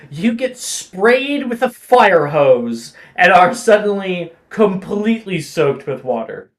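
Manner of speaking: usual speaking voice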